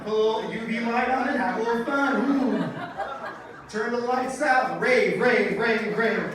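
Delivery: loud party chant; loud, excited mock rave call; mock rave hype.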